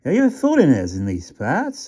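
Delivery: a country rat sizing up strangers; Yorkshire rat. A narrator's reading. Rat questioning Stumps, Yorkshire dialect. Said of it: "asked the rat".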